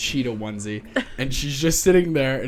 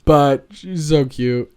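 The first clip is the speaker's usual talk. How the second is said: normal talking voice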